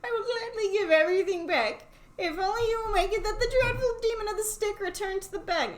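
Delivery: lightly voiced feeble landlord; feeble pleading voice. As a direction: plead weakly for mercy. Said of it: "in a feeble voice".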